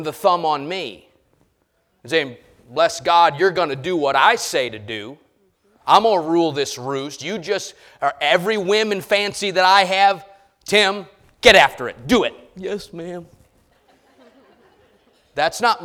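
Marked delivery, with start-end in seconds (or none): 2.7-12.3 a bossy wife barking orders, louder; hammed-up domineering wife, thumb on her husband; mock bossy wife. Pastor acting a wife ordering her husband Tim, loud and bossy. 12.6-13.3 soft, cowed yes ma'am; a henpecked husband giving in, softer; meek husband. Acted. Husband answering his bossy wife, softer and meek.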